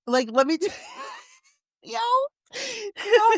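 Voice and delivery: helpless high squeak through laughter; laughing to tears. Real, not acted. Host trying to explain an emotional moment, laughing to tears. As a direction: try to talk while cracking up, voice climbing. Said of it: "I'm laughing so hard that I feel like I could cry".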